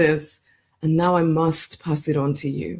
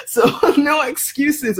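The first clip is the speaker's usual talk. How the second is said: high, slow words broken by a real laugh; laughing through it; 9 semitones higher, slower than usual. Real, not acted. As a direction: laugh out the warning, playful. Laughing about coaching at 3am.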